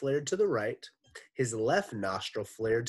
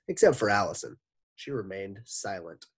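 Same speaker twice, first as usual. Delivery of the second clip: ordinary delivery, nothing marked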